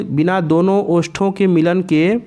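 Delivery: ordinary talk, nothing marked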